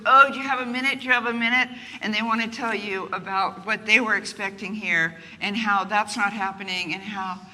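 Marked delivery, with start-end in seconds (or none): 0.1-1.7 loud, high teasing echo; mock eager reply. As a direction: play back the question brightly and loud. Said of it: "the person goes".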